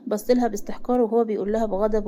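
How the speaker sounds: plain reading voice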